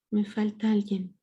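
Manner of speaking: ordinary talk, nothing marked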